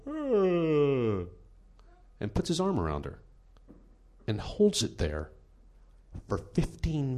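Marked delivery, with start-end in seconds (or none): 0.1-1.2 high, long falling fake yawn; mock yawn-and-stretch noise. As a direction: fake a stretching groan as a sly move. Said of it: "he gives the old".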